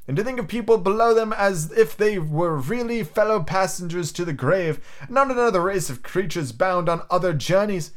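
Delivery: high, lilting, impassioned speech; Scrooge's nephew, warm-hearted and fervent. Acted. Nephew defending Christmas to his uncle, high fervent voice. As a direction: build a heartfelt case, pitched high.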